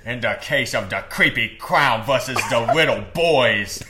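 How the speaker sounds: judge in a goofy put-on accent; loud mock-solemn courtroom announcement; Discord moderator voice. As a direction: pronounce sentence in a silly moderator voice. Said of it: "sentencing John Wayne Gacy in The Voice".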